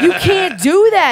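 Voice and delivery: loud, high, drawn-out objection; outraged protest. Retold or imitated. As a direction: call out a drawn-out protest at the referee. Retelling her outraged protest to referees.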